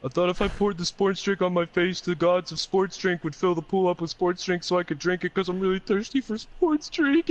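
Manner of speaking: high, loud mock-sobbing confession; hammed-up weepy whine, rising at the end; crying. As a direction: wail it like a stoned friend in tears. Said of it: "he just breaks down crying".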